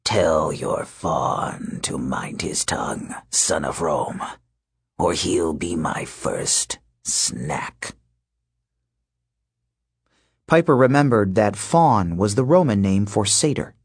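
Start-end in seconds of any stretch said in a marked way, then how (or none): 0.0-8.0 low, slow, whispered menace; snarling wolfman. Acted. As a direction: hiss the threat low and slow. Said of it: "The Wolfman snarled".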